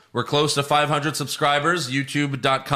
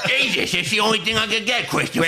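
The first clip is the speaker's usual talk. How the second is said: mock Gandolfini; 7 semitones higher than usual. Mimicking Gandolfini quoting Pesci.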